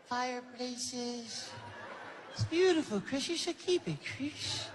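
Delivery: high, soft dreamy Michael voice; Michael gently renaming Chris, high and soft; [name] impression. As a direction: purr it in a high falsetto. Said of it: "He started calling me Christmas".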